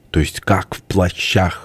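slow, groping question; dawning comprehension. A narrator's reading. Tipsy boss hearing about men in raincoats, slowly catching on. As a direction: stretch it out as sense slowly dawns. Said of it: "в голосе товарища Бурова забрезжил смысл".

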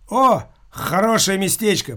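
bright, pleased exclamation; delighted by the place; cheerful exclamation. A narrator's reading. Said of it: "проговорил Генаша".

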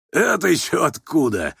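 tickled, mocking surprise; laughing. A narrator's reading. Rogozhin amused by the old man, laughing mockery. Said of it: "засмеялся Рогожин".

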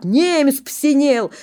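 крик; crowd shout. Acted. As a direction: shout the news. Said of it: "Кричат".

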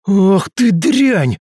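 high, loud, slow spitting fury; spat out in fury. Acted. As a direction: spit the insult out venomously. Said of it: "буквально выплюнул он".

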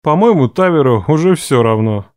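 low smirking male voice. A narrator's reading. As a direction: say it with a dry smirk. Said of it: "усмехнулся Реф". (низкий голос с усмешкой)

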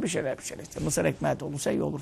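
lightly voiced husband, mumbling his food wishes low and soft; quiet mumbled wish. Husband telling his wife what he wants, mumbled quietly. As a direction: trail off into a quiet wishlist.

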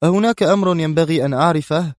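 a touch of Ibrahim's irritation; irritable. A narrator's reading. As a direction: snap it out nervously. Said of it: "قال إبراهيم في عصبية".

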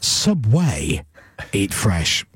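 low voiceover with a drawn-out "way" in "Subway"; deep tagline, second syllable of "Subway" emphasised; stress on way. Shown as a demonstration. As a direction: lean hard on the "way" of "Subway". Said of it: "more emphasis on the word way".

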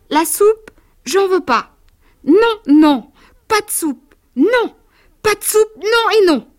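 put-on shrill shrieking child. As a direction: scream the refusal like a furious little boy. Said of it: "de nouveau il s'écria". (enfant hurlant aigu de colère)

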